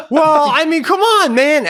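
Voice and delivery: high, loud, exasperated plea; protesting yell. Retold or imitated. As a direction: imitate a stranger shouting in protest. Stranger protests loudly in row.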